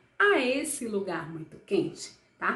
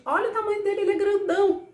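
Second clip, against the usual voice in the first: high, bright showing-off of size; excited wonder; 10 semitones higher, 5 dB louder, much faster than usual. Acted. Teacher showing kids how big he is, excited. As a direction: point it out to wide-eyed kids.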